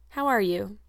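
usual speaking voice

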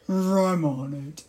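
low, slow animal voice; animal character. Acted. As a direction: draw it out deep and slow like a storybook beast. Frank replies before magic.

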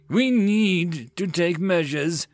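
slow, high-pitched forceful demand; roaring. Acted. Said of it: "the John roared".

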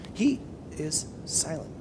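hushed emphasis. Acted. Dramatic pause on silence.

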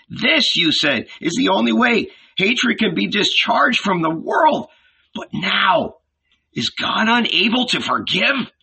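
put-on high, earnest young man's voice; pleading young disciple. A narrator's reading. John questions Jesus about forgiveness, pleading. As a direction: appeal earnestly, voice lifted and urgent.

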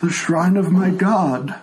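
put-on a frail old man who can't hear; old blind tiefling. Old tiefling NPC dialogue.

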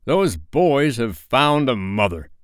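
slow, high, dramatic outcry; drawn-out captain's lament; passionate. A narrator's reading. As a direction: announce the disaster with anguish. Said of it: "he spoke passionately".

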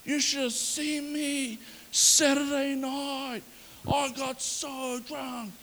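slow, soft, slurred boasting drawl; boasting golfer. Retold or imitated. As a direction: brag about the night like a hungover party guy. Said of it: "it was like".

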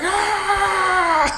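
high, drawn-out imitated scream; mock freak-out yell. Michael freaking out at his mom, mock high yell. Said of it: "Like, yelling at her".

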